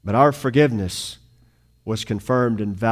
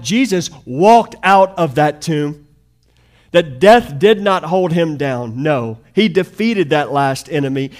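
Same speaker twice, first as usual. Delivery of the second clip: higher and louder, driving sermon climax; full-force preaching; 8 semitones higher, 10 dB louder than usual. Acted. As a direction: preach it hard to the congregation.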